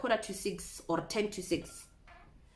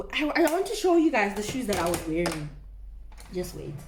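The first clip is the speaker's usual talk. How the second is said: ordinary talk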